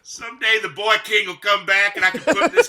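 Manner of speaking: high, loud mock-heroic voice; vengeful waiting kid. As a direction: loudly swear comic revenge. Voicing the kid waiting for revenge.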